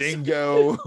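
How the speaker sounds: hammed-up Aussie word; badly faked Australian accent; silly mock-Australian delivery. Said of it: "a bad Australian accent at best".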